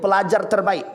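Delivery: ordinary delivery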